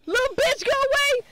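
scared horror game streamer. Real, not acted. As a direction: yell at the monster in panic. Monster chasing, screaming at it.

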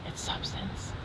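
hushed, stubborn whisper. Real, not acted. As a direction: whisper it, insisting. Whispered insistence in argument.

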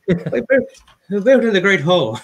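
high, loud mock Irish; extremely Irish accent. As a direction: belt it out in a hammed-up Irish brogue. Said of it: "that was extremely Irish".

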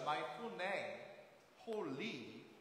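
usual speaking voice

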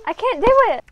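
high, loud cry of giving up; exasperated outburst. Real, not acted. Vlogger giving up on the craft, loud and exasperated. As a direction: wail that it is too much.

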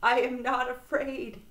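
high, loud shout of bravado; defiant shout. A narrator's reading. Trembling Frog insisting he is brave, shouting. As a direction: shout it to prove you're brave. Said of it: "he shouted".

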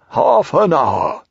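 slow storybook owl musing; Owl settling in. Acted. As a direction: stretch it out like a comfortable owl.

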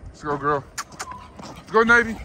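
loud high call to the dogs. Real, not acted. Cheering dogs on outdoors.

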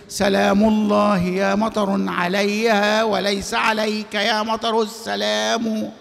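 slow, measured reading of a verse; poem recitation. Shown as a demonstration. Teacher reciting a poem example to the class, slow and measured. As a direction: draw out the line like classical poetry. Quoting a line of poetry.

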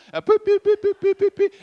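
high, rapid voiced keypad beeps; phone dialing beeps. Dialing the house phone to call friends, high beeping. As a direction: tap out the number with your voice. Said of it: "my opportunity to create sound effects".

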